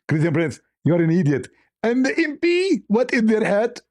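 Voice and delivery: high put-on voice of an angry caller; high scolding impression voice; mock Zakir Naik. S0 acts out Zakir Naik phone call.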